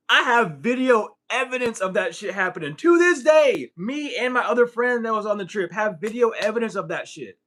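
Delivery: high, loud, incredulous declaration; emphatic insistence. Real, not acted. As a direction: hammer it home, peaking in a shout on TO THIS DAY. Insists there is proof.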